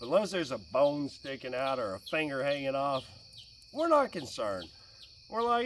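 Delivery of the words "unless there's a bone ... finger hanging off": ordinary talk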